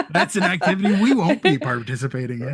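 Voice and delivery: laughing at your own punchline; can't hold the laugh in. Real, not acted.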